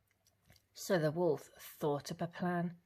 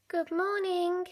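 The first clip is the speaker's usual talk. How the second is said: put-on high little girl calling; a cheerful girl greeting the woods; high sing-song greeting; 13 semitones higher, 4 dB louder than usual. A narrator's reading. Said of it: "she called to the rabbits".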